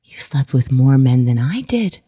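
low, measured disbelief, imitating a mother; imitating a mother's heavy pronouncement; stunned, grave reply. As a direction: deliver it low and weighty, as a retold shock.

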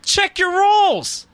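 drawn-out high teasing voice; high mock voice. Mock-dramatic aside.

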